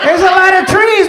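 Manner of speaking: high, loud protest over gunfire; yelled excuse. Retold or imitated. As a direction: shout back a reason not to do it. Yells excuse during retold firefight.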